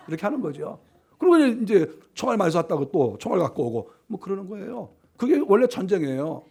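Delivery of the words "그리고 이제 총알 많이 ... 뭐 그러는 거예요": ordinary talk, nothing marked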